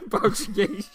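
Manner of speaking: laughing, drawn-out Portuguese; mock Portuguese accent. Host laughing at his mock Portuguese accent. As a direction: stretch Portuguese into a jokey Portuguese accent. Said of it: "That famous accent".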